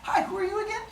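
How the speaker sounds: high-pitched puzzled question; acted high-voiced query; questioning a stranger. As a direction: ask it high, like a character in a tale.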